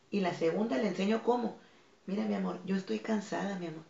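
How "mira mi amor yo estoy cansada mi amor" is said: put-on gentle, softer voice to a child; soft low voice. Tired mother speaks softly to her child, softer. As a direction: hush it down like a tired mom. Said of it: "con la voz baja".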